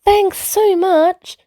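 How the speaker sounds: little bird thanks the wise bird, delighted; excited thanks. Acted. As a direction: squeal your thanks as a happy little bird.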